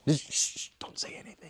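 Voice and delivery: hushed whisper, shushing. Pretend. Child hushes the reader after the shocking fact, whispering. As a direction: whisper as if keeping a secret. Hushing after the dramatic reveal.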